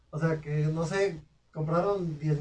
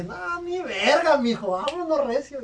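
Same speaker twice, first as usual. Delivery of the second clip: agudo, fuerte y lento en el "ni verga"; actúa la decisión de huir con drama; decisión exagerada; 9 semitones higher, 4 dB louder, slower than usual. Retold or imitated. Retold inner decision to escape. (se dice a sí mismo que se va, exagerado)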